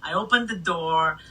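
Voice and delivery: ordinary talk, nothing marked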